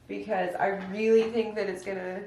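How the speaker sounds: usual speaking voice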